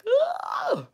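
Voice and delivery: sudden high shriek of joy; excited shriek. Retold or imitated. Retelling release news, a high joyful shriek. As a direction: let out a high excited scream. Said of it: "I just got really fucking excited".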